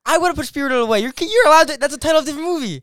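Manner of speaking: very high, loud indignant yelling; outraged protest. Real, not acted. Co-host disputes the drinking penalty, yelling indignantly. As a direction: shout in protest at an unfair call.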